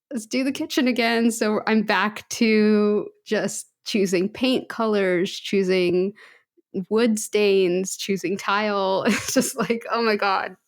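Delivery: ordinary talk, nothing marked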